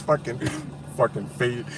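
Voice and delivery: drawn out through laughter; laughing slow mock insult. Real, not acted. Streamer teasing through laughter, slow. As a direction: tease through giggles.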